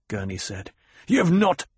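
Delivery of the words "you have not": high, loud and cut short; heated protest. A narrator's reading. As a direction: object loudly until silenced. Gurney protests, gets cut off.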